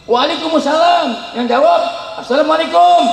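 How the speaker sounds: reply first, greeting second; reversed salam demo; mock wrong-way greeting; wrong order. Shown as a demonstration. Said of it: "Jangan terbalik".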